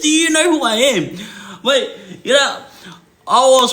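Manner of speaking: very high, laughing squeal of a quote; cracking up. Real, not acted. Storyteller repeats old lady's challenge, cracking up. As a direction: repeat her challenge while helpless with laughter.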